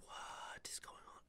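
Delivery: confused whisper. Retold or imitated. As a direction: whisper it, bewildered. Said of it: "I'm just like".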